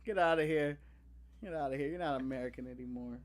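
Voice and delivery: low, hushed mock disappointment; quiet mock rejection. Fan disowns the English actor, quiet mock hurt.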